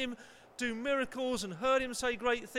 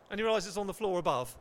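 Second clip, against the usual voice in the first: usual speaking voice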